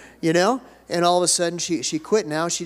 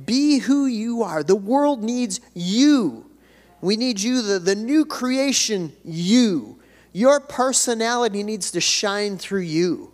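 rising preaching fervor; 5 semitones higher, slower than usual. Acted. Pastor urging the church to know who they are, rising in fervor. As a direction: lift the congregation with each phrase.

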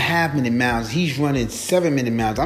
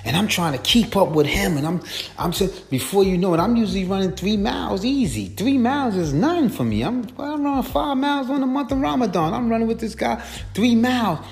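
1 excited retelling; 9 semitones higher than usual. Retold or imitated. Reliving the race he lost.

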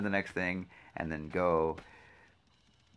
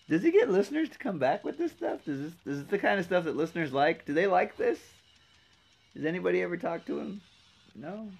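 much higher teasing put-on voice; mock high voice; 9 semitones higher, 3 dB quieter than usual. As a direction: ask it in a silly high voice. Voicing a questioning outsider.

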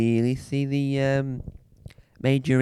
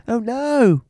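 frightened cry; 9 semitones higher, 6 dB louder than usual. Acted. Sees shadow, cries out.

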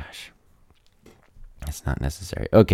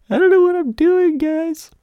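squeaky falsetto; 5 dB louder than usual. Real, not acted.

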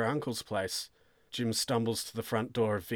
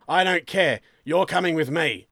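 loud, higher, cutting him off; angry command; 6 semitones higher, 7 dB louder than usual. Acted. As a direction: overrule his protest forcefully. Sergeant cuts off protest.

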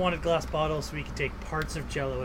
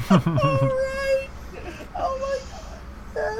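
very high squealing through laughter; cracking up, voice squeaking high; hearing the funny story, squealing with laughter; laughing squeal; much slower than usual. Real, not acted.

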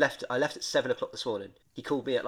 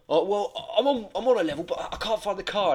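huffy dad voice; 8 semitones higher, 4 dB louder than usual. Retold or imitated. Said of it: "he didn't take it very well".

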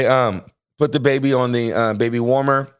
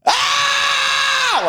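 very high, drawn-out mock scream; imitated labour scream. Husband imitates wife screaming as baby comes out, piercing mock scream. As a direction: shriek like a woman giving birth. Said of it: "she screams".

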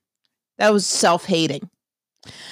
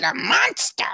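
high-pitched, disbelieving question; incredulous echo; 12 semitones higher, slower than usual. Retold or imitated. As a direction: echo it back in shock, pitch leaping up. Echoes gossip in disbelief.

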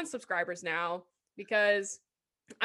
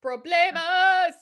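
put-on high, slow, loud Spanish accent; hammed-up Spanish "Problemas."; 8 semitones higher, 9 dB louder, slower than usual. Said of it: "a Spanish accent".

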